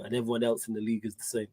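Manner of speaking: ordinary talk, nothing marked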